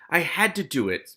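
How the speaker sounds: mild moaning protest; moaned. A narrator's reading. Ratcatcher Two excusing himself to his captors, mildly moaning. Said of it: "Ratcatcher Two moaned".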